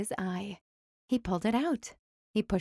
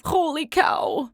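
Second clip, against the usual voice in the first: holler; 7 semitones higher, 5 dB louder, slower than usual. A narrator's reading. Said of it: "said exactly what he thought".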